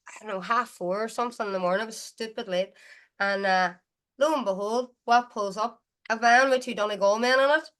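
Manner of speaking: normal talking voice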